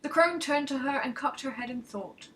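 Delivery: usual speaking voice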